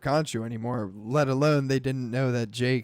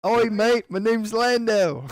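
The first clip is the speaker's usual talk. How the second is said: high, louder mock Aussie; hammy Australian introducing himself; bad Australian accent; 6 semitones higher, 3 dB louder than usual. Playing an Aussie Lando for his friend, mock Australian. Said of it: "my Australian accent impression".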